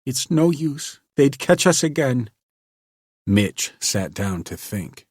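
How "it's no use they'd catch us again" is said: dull despair. A narrator's reading. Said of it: "shook her head dully".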